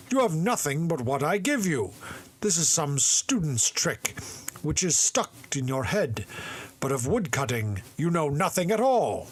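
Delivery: high, loud scolding father; father shouting at his simpleton son; angry exclamation. A narrator's reading. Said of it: "But the father exclaimed".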